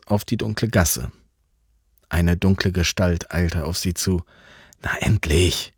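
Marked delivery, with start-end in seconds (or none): 4.8-5.7 urgent whisper in the dark. Acted. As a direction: hiss it so nobody hears. Waiting in the dark alley.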